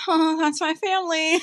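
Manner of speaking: high, laughing mock of mom; laughing as mom who hears her family; laughing mom impression. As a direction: squeal it while cracking up. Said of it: "She goes".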